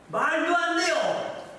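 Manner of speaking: high, slow, disbelieving protest; Peter protesting. Acted. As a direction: protest like Peter refusing the cross. Peter protesting Jesus's death. (베드로처럼 높고 느리게 항의하듯)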